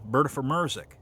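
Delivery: "Berta Merzik" with ER in place of the U sound; Memphis ER vowel. Shown as a demonstration. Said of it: "replaced with, um, you know".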